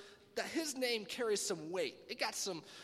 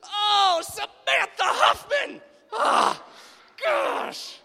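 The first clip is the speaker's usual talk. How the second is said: loud, high, slow yell; hammed-up pained yell; pained shout; 14 semitones higher, 13 dB louder, much slower than usual. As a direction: yell a random name as a curse. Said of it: "Why don't we be like".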